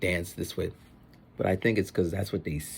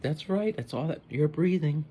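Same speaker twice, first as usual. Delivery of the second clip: higher-pitched, lightly voiced parent; approving parent; 9 semitones higher than usual. Parent agreeing with slacker kid, lightly imitated approval. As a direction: humour the kid in a mock parent voice. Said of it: "some parents like".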